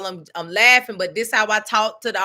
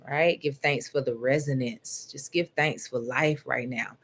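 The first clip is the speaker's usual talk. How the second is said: guided meditation; 6 semitones lower than usual. Acted. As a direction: ease them down gently into stillness. Leads a meditation.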